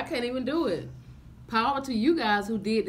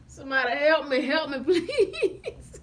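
laughing plea; 4 semitones higher, 6 dB louder than usual. Real, not acted. Gagging on baby food.

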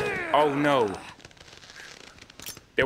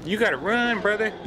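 being chased; 10 semitones higher than usual. Real, not acted. Boss chasing Leon in game.

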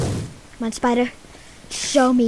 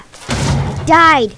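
ordinary talk

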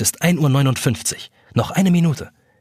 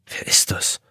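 whispered question; slower than usual. Acted. Jonas startled alone at night.

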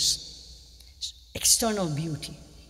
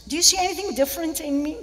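higher, louder mock-expectant question; fishing for compliments; 9 semitones higher, 5 dB louder than usual. Woman acting out waiting to be complimented, higher and fishing. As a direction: ask it high, hinting for praise.

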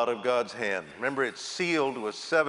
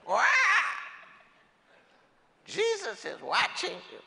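high squawking parrot voice, much higher. Acted. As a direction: squawk it like a parrot again, rising at the end. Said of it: "And the parrot says".

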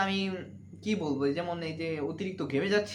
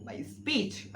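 whisper; 3 semitones higher, 5 dB quieter than usual. Shown as a demonstration. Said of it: "আমার বক্তব্য".

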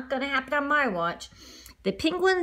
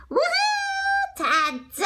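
high, squealing cheer; excited whoop; much slower than usual. Acted. Lemur cheering as the cake pops out, excited squeal. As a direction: whoop with delight at the surprise.

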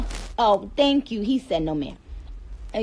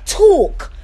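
put-on British accent; 9 semitones higher, 6 dB louder than usual. Mimicking how British people say it, loud British TALK. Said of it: "British don't say talk".

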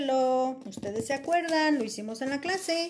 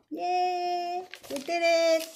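happy yay; 6 semitones higher, 3 dB louder, slower than usual. Real, not acted. Fan finished, celebrating.

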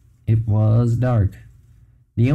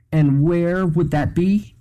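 higher, slow, fed-up question; exasperated; 7 semitones higher, slower than usual. Acted. Jet pressing the evasive old man, exasperated. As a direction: drag it out, done with this. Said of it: "he asked in exasperation".

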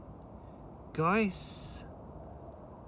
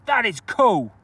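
excited cool; 7 semitones higher, 10 dB louder than usual. Real, not acted. Repeated cool, loud then soft.

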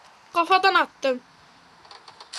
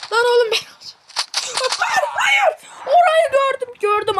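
high, loud furious no; angry shout; 8 semitones higher, 5 dB louder than usual. Real, not acted. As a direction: yell it out in a rage. (yüksek sesle öfkeli haykırış)

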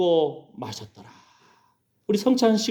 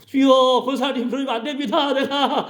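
mock complaining churchgoer; 8 semitones higher than usual. Preacher mimics complaining church member.

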